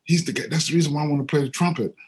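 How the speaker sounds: put-on high, fast, eager child's voice at the hero; tugging at dad, bursting with excitement; excited kid. Boy telling his dad he's seen his hero, high and fast.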